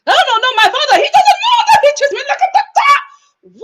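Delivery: high, loud mock French accent; garbled, hammed-up French accent; [name]. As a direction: squeal it in an exaggerated French accent. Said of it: "dealing with this accent".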